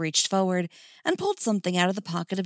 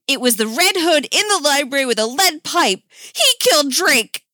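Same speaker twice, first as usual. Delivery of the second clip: high, loud accusing shout; 9 semitones higher, 9 dB louder than usual. Acted. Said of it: "Damien shouted".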